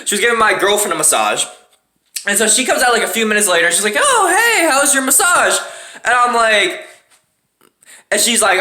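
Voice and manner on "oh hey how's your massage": high chirpy wife voice. Retold or imitated. Wife greets her after massage.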